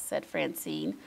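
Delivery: usual speaking voice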